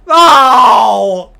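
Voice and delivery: high, very loud drawn-out howl; long anguished shout; agonized scream. Real, not acted. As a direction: scream it in despair. Pick goes the wrong way.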